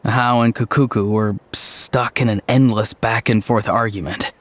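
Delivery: ordinary talk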